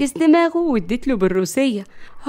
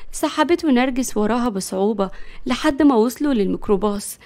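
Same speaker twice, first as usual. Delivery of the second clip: usual speaking voice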